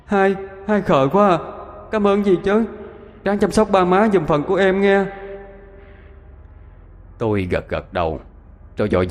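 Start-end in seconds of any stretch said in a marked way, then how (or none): 0.1-5.1 put-on high, gentle child's voice; a small child teasing fondly; little sibling's voice.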